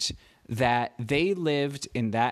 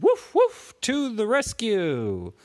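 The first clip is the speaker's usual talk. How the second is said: much higher, louder yapping puppy bark, then a slow heroic call; eager puppy voice; 8 semitones higher, 4 dB louder, slower than usual. Acted. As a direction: bark high like a tiny hero dog arriving. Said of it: "And the puppy said".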